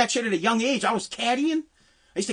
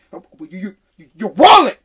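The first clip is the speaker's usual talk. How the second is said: stuttering caddy master; 4 semitones lower, 9 dB louder than usual. Retold or imitated. Caddy master threatening a boy's pay, stuttering outburst. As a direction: stick on "you", then burst out. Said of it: "He said it just like that".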